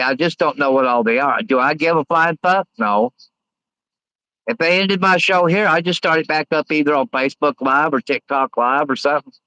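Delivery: ordinary delivery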